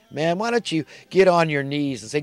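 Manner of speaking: ordinary delivery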